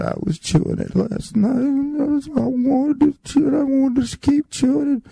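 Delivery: put-on high, tearful little-boy plea; crying child. Boy crying to the house mother about his gum. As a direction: whimper it out like a kid in trouble.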